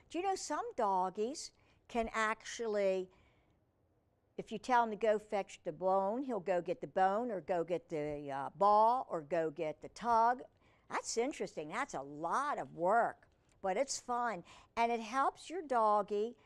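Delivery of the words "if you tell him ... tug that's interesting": usual speaking voice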